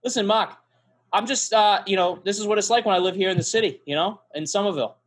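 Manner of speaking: Boston townie reminiscing; hammed-up Boston local with dropped r's; mock Boston accent. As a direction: placate him in a put-on Boston accent. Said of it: "Why are you fucking talking like me".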